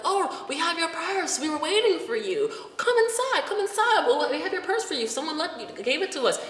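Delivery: hammed-up eager hostess welcoming her back; imitating friendly staff. Staff greeting her with her lost purse, sweetly high.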